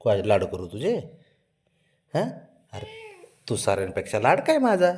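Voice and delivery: ordinary talk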